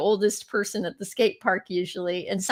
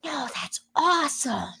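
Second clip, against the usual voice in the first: put-on little girl; 4 semitones higher, 5 dB quieter than usual. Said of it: "And she was like".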